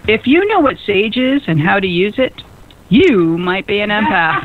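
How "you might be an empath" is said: slow punchline. Shown as a demonstration. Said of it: "here's one for you".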